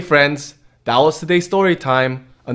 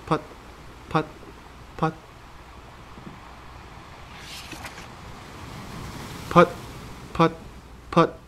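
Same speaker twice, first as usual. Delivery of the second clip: puttering motor; 2 semitones higher, 12 dB quieter than usual. Acted. Boat motor sound effect.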